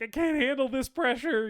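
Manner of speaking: high panicked squeal; panicky voice. Retold or imitated. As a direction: play the sweating, cracking guy. Sweating guy voiced in retelling.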